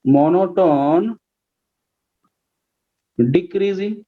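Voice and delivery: slow, stretched syllables; drawn-out dictation. Real, not acted. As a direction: say it as you write it out. Writing out the term while saying it. (धीरे-धीरे लिखते हुए बोलना)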